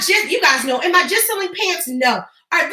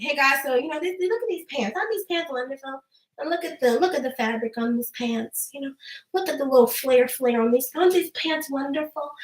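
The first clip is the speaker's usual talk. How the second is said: soft, listless mock selling; boring sales pitch; 6 dB quieter than usual. Shown as a demonstration. Dull seller showing pants to live viewers, soft bored monotone. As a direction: praise the pants quietly with no real enthusiasm. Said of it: "The boredom".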